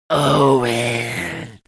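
loud, drawn-out slurred name; slurred monster growl. A narrator's reading. Drooling rat beast calls to Owen, slurring. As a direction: slur it through a broken jaw. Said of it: "it slurred again".

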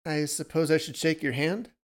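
lightly acted sheepishness; sheepish offer. A narrator's reading. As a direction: offer it awkwardly, half asking. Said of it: "smiled sheepishly".

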